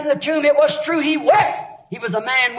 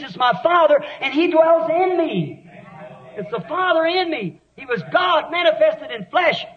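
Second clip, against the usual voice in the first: rising, shouted pulpit proclamation; preaching at full force; 4 semitones higher than usual. Acted. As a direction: hammer it home to the congregation.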